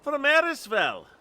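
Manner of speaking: high, loud Scottish exclamation; Scottish accent. Acted. Scottish lookout answering visitors, loud Scottish accent. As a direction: call it out in a put-on Scottish accent. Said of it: "get the Scottish accent going".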